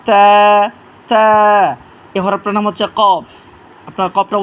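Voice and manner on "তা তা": তা with a briefly lengthened vowel; tongue tip at tooth roots, hold তা briefly; drawn one count. Shown as a demonstration. Teacher models a letter for learners, one count long. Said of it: "এক আলিফ টেনে পড়বেন".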